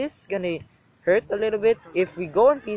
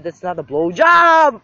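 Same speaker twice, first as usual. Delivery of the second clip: indignant shout; 8 dB louder, slower than usual. Real, not acted. Loud indignant retort.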